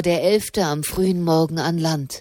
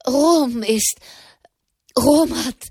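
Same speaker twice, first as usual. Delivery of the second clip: high, broken fragments, struggling to get words out; too agitated to finish, gasping between attempts; breathless stammering; 5 semitones higher than usual. A narrator's reading. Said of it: "brachte Tommaso di Petra heraus". (atemlos herausgebracht)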